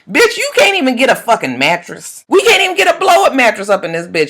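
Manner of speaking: ordinary delivery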